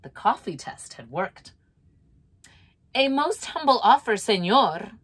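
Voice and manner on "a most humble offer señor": coolly. A narrator's reading. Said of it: "she said coolly".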